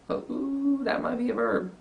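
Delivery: playful teacher surprise; mock surprise. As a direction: act delighted to spot a verb. Teacher feigns discovering a verb.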